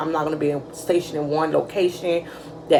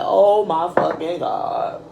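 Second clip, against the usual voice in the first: stunned disbelief; much slower than usual. Retold or imitated. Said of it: "I'm like".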